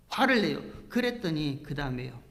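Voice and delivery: ordinary talk, nothing marked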